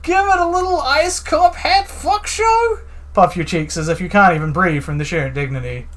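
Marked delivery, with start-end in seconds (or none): 0.0-2.8 high, slow, overblown outrage; milk the cop's wounded dignity; squeaky scandalized cop; hammed-up indignation.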